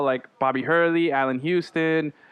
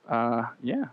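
usual speaking voice

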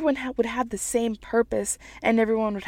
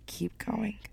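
breathy whispered last words; hushed whisper to end the poem; 11 dB quieter than usual. Acted. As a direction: whisper it like a secret. Whispered close of her piece.

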